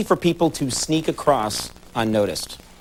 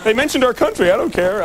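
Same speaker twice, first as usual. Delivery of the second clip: higher, lightly voiced excited Canadian guy; Canadian fan; 7 semitones higher than usual. Host imitating a Canadian audience member, mock cheer. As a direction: cheer it like a fan who barely cares. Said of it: "He was like".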